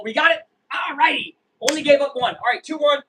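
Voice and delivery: pumped fan voice; fired-up relief. Real, not acted. Celebrating the double play.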